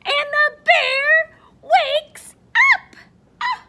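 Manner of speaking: high, loud, slowed exclamation; climactic shout. A narrator's reading. Storyteller startles children as the bear wakes, shouting. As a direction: shout it out word by word.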